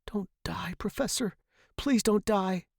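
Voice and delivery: high, hushed plea; pleading. A narrator's reading. Student to dying professor, pleading. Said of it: "pleaded with him".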